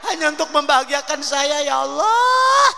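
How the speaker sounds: high, loud, tearful wailing; crying wife. Acted. Wife weeping over her husband's ruined backside, wailing. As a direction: wail it through tears. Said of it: "Bini nangis".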